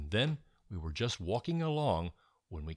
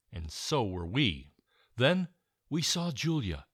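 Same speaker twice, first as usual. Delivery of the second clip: plain reading voice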